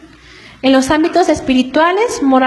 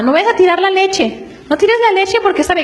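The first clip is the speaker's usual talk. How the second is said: mother's voice, pitched higher, cautioning; warning mother; 5 semitones higher than usual. Retold or imitated. Mother warns her two-year-old about the milk, higher mock-scolding. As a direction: play the mom telling the toddler not to spill. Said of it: "dice la mamá".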